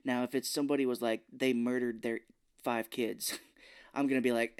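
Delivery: normal talking voice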